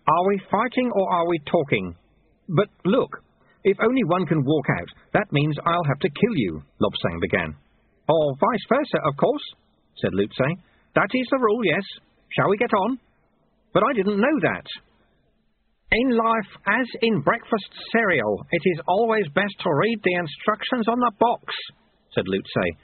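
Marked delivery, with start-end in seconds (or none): 0.1-6.6 lightly voiced pupil, higher pitch; raised-pitch young student questioning. A narrator's reading. 8.1-13.0 high, brisk-minded master; raised-pitch old sweeper. A narrator's reading. Sweeper coolly answers death worry. 13.7-14.6 protesting apprentice. A narrator's reading. Apprentice protests the deadly rule. 15.9-21.5 high, sage character voice; old master lecturing his pupil. A narrator's reading.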